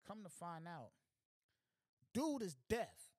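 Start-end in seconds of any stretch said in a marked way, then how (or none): 2.1-3.1 high, loud, slow reveal; loud punchline reveal. Acted. Storyteller loudly revealing that the robber is deaf. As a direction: land the twist big and slow.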